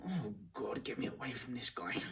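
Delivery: whispered aside of dread; a put-upon whisper wanting escape; annoyed inner whisper. Retold or imitated. Stranger's inner reaction, annoyed.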